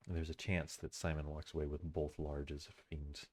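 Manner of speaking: usual speaking voice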